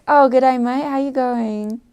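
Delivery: self-conscious Australian accent attempt; imitated Australian accent, loud at the start. Shown as a demonstration. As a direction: put on a hearty Aussie greeting. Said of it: "I hate impersonating the accent".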